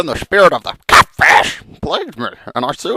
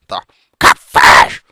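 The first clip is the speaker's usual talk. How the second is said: deep, slow ominous whisper; name the monster with dread; 7 dB louder, slower than usual. Acted.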